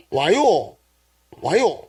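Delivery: short Gyeongsang "why" with arched tune; Gyeongsang dialect. Shown as a demonstration. Preacher condenses "why are you doing that", in Gyeongsang dialect. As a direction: punch it out with a Gyeongsang rise and fall. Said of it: "경상도 사투리로".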